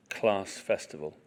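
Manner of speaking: ordinary delivery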